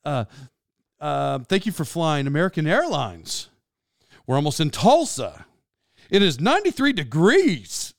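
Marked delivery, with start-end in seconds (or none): 1.4-7.9 cabin announcer with each last word swung up; mock airline announcement, lifting the final word; airplane guy. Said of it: "like the airplane guy".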